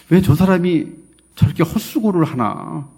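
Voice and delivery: ordinary talk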